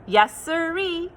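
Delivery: usual speaking voice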